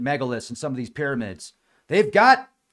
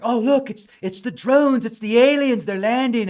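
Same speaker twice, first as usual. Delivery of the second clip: high, loud mock panic; mock freaking out; 8 semitones higher, 6 dB louder than usual. People reacting to AI videos, mock freak-out. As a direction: mimic gullible people frantically spotting aliens. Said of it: "people are just freaking out".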